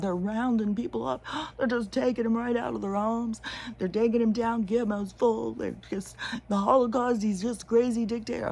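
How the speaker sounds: shrieking parody of alarmed people; mock hysteria. Mocking people freaking out over roundups, hysterical parody. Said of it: "These people are freaking out".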